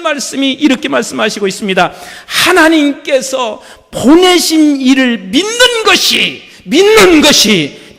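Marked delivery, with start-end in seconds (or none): 2.3-7.9 slow, loud, weighty scripture read; slow louder preached verse; preaching scripture. Acted. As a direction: lean into each repeated phrase, building. Reads scripture with rising force.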